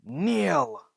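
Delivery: booming high command; commanding shout. Acted. Deku ordering the villains to kneel, booming shout. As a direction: roar the order so all obey. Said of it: "And then just shouts".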